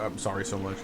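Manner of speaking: a self-conscious low repeat; mumbled repeat. Shown as a demonstration. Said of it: "it sounds weird when I say it that way".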